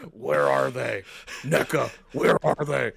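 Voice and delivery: loud, slow Batman demand. Pretend. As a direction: growl it like Batman interrogating.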